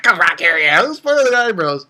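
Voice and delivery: high cartoon rant; imitated angry character. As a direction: act out a hammed-up chase after a teasing kid. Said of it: "And he's like".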